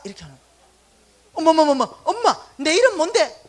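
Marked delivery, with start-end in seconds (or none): 1.3-3.4 loud, higher, slowed alarm; much louder alarmed daughter; startled outcry. Retold or imitated. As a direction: gasp out in shock at your mother. Shocked daughter to confused mother.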